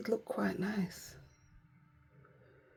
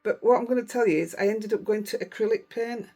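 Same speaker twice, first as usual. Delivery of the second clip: ordinary talk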